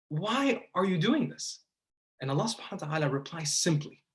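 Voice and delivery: ordinary talk, nothing marked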